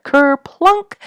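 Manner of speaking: high, loud splashy ker-plunk; playful splash word. A narrator's reading. Reading to children, a loud high splash. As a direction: make the splash jump out.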